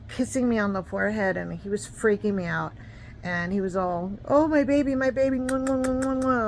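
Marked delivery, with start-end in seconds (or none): 4.2-6.5 smother her with kisses; doting dad. Retold or imitated. Boyfriend's dad cooing over her in the trauma unit, high with kiss sounds.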